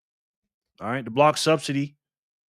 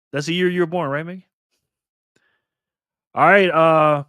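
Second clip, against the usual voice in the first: usual speaking voice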